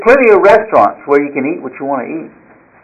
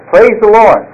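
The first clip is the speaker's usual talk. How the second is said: high, raised cry of praise; mock shout; 7 semitones higher, slower than usual. Lady finding the groceries, shouting her thanks. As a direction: let the praise ring out. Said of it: "and she shouted".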